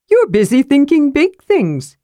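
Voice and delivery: high, bouncy little character voice; Tass speaking. A narrator's reading. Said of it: "said Tass".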